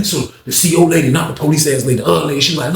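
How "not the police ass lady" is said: ordinary talk, nothing marked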